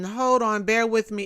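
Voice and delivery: usual speaking voice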